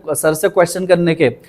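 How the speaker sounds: usual speaking voice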